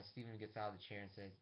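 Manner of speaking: ordinary delivery, nothing marked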